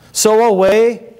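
preacher's forceful sending away; dismissive cry. Real, not acted. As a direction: cast them off with the word. Preacher banishing the Pharisee.

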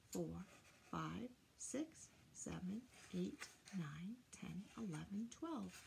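soft whispered skip count. Shown as a demonstration. Teacher whispering the count to children. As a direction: whisper the numbers like a secret.

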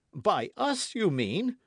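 high-pitched correcting interjection; affronted young man's exclamation; indignant cry. A narrator's reading. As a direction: cut in hotly, pitched well up. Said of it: "cried D'Artagnan".

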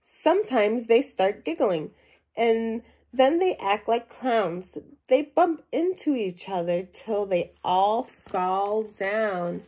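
lively storybook reading to a class; animated read-aloud voice for young listeners; reading to children. Acted. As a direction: read it out like a picture book for kids. Teacher reads picture book aloud.

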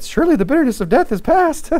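high, dainty mock nonchalance; hammed-up delicate plea; delicate Agag. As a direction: say it in a prissy high voice. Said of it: "He came delicately".

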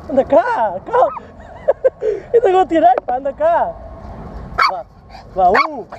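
high coaxing voice to an animal; coaxing a pet. Real, not acted. (voz aguda a chamar o animal)

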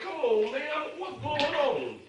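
high, drawn-out retold exasperation; exasperated. Retold exasperation at more police arriving.

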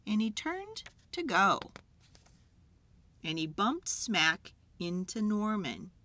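usual speaking voice

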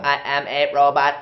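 one tone robot voice; flat monotone like a robot. Shown as a demonstration. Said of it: "Just one tone".

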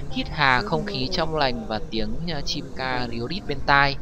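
ordinary talk

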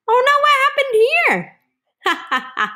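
high, loud mock alarm; exclaimed dismay. Reader shows children the dog's mishap, high exclaimed dismay. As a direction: squeal it in exaggerated surprise. Voiced reaction to picture in read-aloud.

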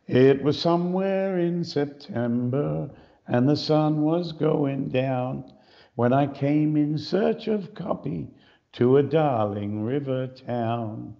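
slow, drawn-out Australian accent recital; broad Australian accent, lingering on each line. Shown as a demonstration. Showing how Australians really sing, in a broad Australian accent. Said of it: "That's typical Australian".

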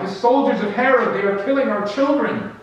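high, loud panicked outcry; desperate cry. Acted. Villagers crying out in terror at the soldiers.

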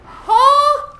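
loud, high yelp of disbelief; shocked shout. Real, not acted. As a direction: blurt it out stunned. Reacting to shocking comment.

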